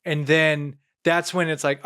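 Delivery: ordinary talk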